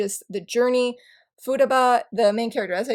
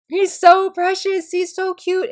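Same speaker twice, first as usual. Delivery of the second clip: cooing; 8 semitones higher, 5 dB louder, slower than usual. Real, not acted. Cooing over Hinata sticker.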